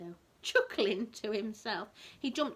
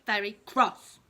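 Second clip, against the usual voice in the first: very punched much louder; emphatic very cross; 11 dB louder, slower than usual. A narrator's reading. Telling a child the passengers were cross, emphatic. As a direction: lean hard on very cross.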